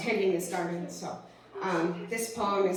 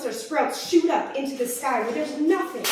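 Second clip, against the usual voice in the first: higher, swelling slam delivery; hopeful poetic build; rising spoken-word poetry; 5 semitones higher, 4 dB louder than usual. Acted. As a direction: lift the line upward as the poem builds. Recites own slam poem.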